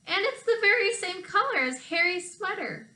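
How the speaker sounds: put-on high, eager children's cry; children shouting their discovery together; children telling Grandma the nest matches, high and eager; excited shout. A narrator's reading. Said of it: "they shouted together".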